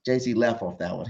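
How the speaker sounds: ordinary delivery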